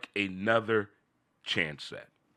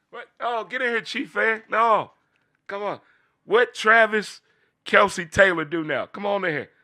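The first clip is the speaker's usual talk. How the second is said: high teasing taunt; 10 semitones higher, 4 dB louder than usual. Real, not acted. Teasing Chiefs fans, high.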